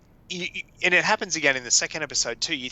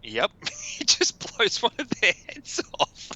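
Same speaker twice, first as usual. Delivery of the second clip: usual speaking voice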